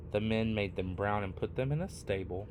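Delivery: ordinary delivery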